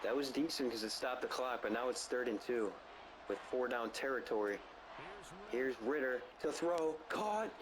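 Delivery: running sportscaster commentary; play-by-play call. Acted. Gamer calling the final drive, play-by-play. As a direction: narrate each snap like a broadcast. Plays announcer over Madden game.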